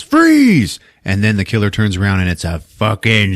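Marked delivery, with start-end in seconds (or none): 0.0-0.8 high, loud shout of "Freeze!"; cop yell. Retold or imitated. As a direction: bark it at the killer down the barrel. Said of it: "He points the shotgun and yells".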